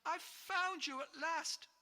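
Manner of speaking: high, loud and overjoyed; a boy bursting with joy; thrilled. Acted. George to the giant, thrilled.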